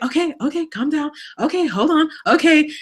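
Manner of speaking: high-pitched, flustered placating; harried mom. Retold or imitated. Mom re-enacting replies to her children, high and harried.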